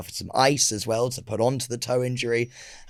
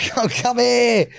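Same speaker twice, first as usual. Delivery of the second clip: high, drawn-out come-hither coo; acted baby-talk to a pet; coaxing a Chihuahua; 9 semitones higher, much slower than usual. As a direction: call a tiny dog in a high voice. Calling the imagined Chihuahua over.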